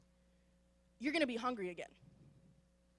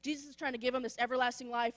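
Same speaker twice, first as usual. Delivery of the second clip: usual speaking voice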